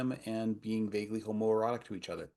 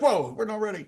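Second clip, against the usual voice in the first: surprised; 12 semitones higher, 7 dB louder than usual. Retold or imitated. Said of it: "We were like".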